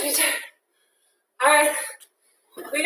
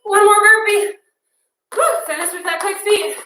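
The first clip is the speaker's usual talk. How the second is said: rally them for one more; urging on; 6 semitones higher than usual. Real, not acted. Pushing class through last burpee.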